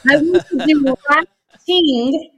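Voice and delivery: lightly voiced Turkish-accented English, slow; a hint of his Tinglish. Wife imitating husband's Turkish English, lightly accented. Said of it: "in his Tinglish".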